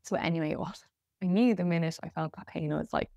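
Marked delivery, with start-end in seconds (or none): none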